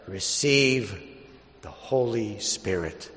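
solemn measured liturgical reading; Gospel reading. Acted. Proclaims the Gospel at Mass.

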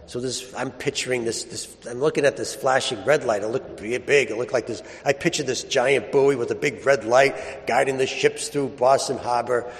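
ordinary delivery

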